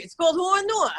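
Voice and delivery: "Horror Noir" in thick New York, as "haw-uh nwah"; real New York accent. Shown as a demonstration. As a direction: announce "Horror Noir" like a proud New Yorker. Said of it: "in my real accent".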